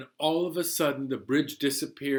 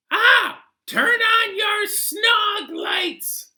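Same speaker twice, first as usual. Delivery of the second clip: high, loud cartoon passenger, slow and sure; odd passenger voice; 8 dB louder, much slower than usual. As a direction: squeak it out like a goofy know-it-all. Passenger voice shouting.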